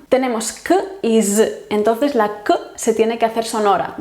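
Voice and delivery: usual speaking voice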